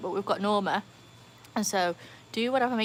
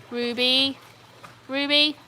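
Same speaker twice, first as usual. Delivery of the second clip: louder, raised call to a dog; 4 semitones higher, 5 dB louder, slower than usual. Real, not acted. Owner calls her dog off the hose, raised voice. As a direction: call the name firmly, twice.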